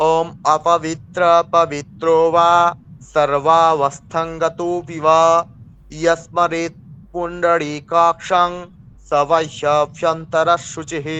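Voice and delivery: slow, loud chanted Sanskrit mantra; mantra recitation. Shown as a demonstration. Teacher recites a mantra to the class, slow and chanted. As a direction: intone each word slowly, fully. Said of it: "আমি আপনাদের একটা মন্ত্র বলছি".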